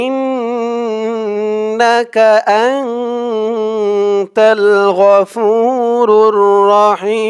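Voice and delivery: slow chanted recitation of the phrase; টেনে টেনে chanted reading; drawn-out recitation. Shown as a demonstration. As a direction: recite slowly, held vowels. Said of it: "টেনে টেনে বলে দিব".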